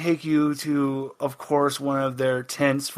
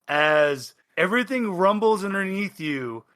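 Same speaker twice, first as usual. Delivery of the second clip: normal talking voice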